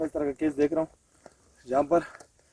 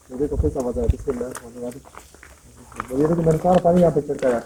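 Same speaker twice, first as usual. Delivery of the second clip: normal talking voice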